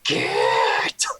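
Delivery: deep, loud orcish bark; orc roar. Acted. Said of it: "just roars".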